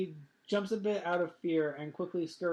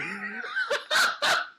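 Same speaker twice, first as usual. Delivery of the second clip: loud high laugh; 5 dB louder than usual. Real, not acted. Big laugh after joke.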